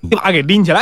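ordinary delivery

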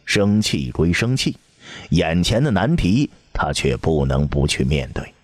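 usual speaking voice

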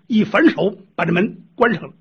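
plain reading voice

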